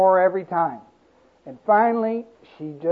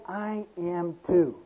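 humble reply; 2 semitones lower, 10 dB quieter, slower than usual. Acted. Preacher models humble reply.

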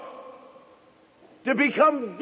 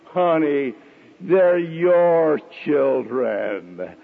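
pious; 7 semitones lower, much slower than usual. Retold or imitated. Husband hands the children back to his wife, mock pious. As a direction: lower and very slow, a mock sanctimonious drawl. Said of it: "he's saying so piously".

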